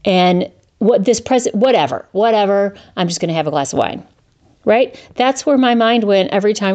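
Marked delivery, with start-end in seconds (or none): none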